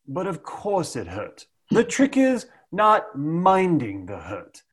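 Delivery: put-on English accent. A sales coach imitating Lawrence of Arabia in an English accent. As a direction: put on a cool English accent, taking your time. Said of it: "the best English that you're going to get out of me in terms of an English accent".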